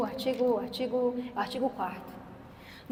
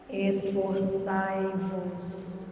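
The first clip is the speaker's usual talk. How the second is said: drawn-out, deep "Esforçai-vos"; very slow solemn; 6 semitones lower, much slower than usual. Shown as a demonstration. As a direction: stretch every syllable of "Esforçai-vos" solemnly. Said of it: "Essa foi a resposta de Jesus".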